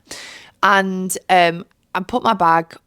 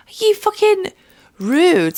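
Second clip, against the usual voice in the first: mock outrage; 12 semitones higher, 4 dB louder, slower than usual. Outraged at rude seatmate.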